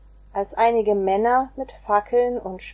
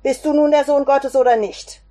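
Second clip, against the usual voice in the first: higher and louder, the council pressing him; demanding shout; 5 semitones higher, 6 dB louder than usual. Acted. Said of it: "die anderen riefen". (lautes, forderndes Rufen)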